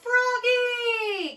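high, drawn-out holler; mother calling loudly from afar. A narrator's reading. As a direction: sing out the name high and long. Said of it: "called his mother".

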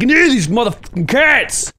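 frantic, over-the-top demand; acted desperation. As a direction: yell it as if desperately craving. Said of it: "I'm just like".

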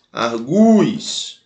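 "Argus" as ar-GU-is, U held long; stressed U pronounced. Shown as a demonstration. Teacher repeating the verb for students, "Argus" with a long stressed U. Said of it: "A pronúncia continua".

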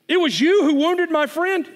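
high and loud, shocked accusation; accusing boy. Acted. Boy accusing the lion of hurting his friend, shocked. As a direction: blurt it out in shock.

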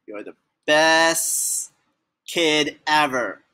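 plain reading voice

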